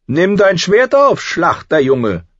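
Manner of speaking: high, loud, slow command; demanding prince. A narrator's reading. Prince challenging the butcher's boy, demanding. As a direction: order him slowly and loudly. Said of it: "verlangte er".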